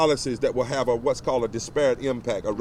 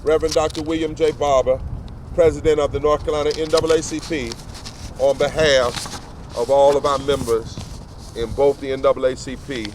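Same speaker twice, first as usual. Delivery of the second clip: rising oratory, paced and emphatic, a touch louder; formal speech; 3 semitones higher, 3 dB louder than usual. Acted. Reverend reading an open letter to the press, in formal oratory. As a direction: preach the letter like a pulpit address.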